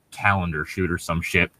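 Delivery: normal talking voice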